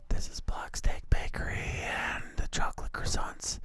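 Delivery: hushed ASMR whisper. Real, not acted. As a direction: whisper softly for the mic. Both whisper for ASMR tasting.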